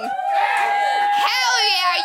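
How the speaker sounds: hype shout. Real, not acted. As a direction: whip the crowd up. Host hypes crowd.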